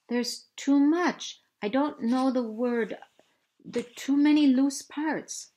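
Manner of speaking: put-on high, breathless girl searching for a word; a girl hunting for the English word; young girl voice. Rachel tells Matt she can't find the word, high and breathless.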